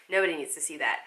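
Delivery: ordinary talk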